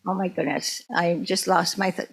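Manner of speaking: ordinary delivery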